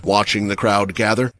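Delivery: plain reading voice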